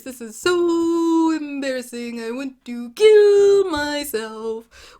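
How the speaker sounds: loud, slow, booming bear. Acted. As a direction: roar the words like a big bear. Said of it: "this is a bear voice".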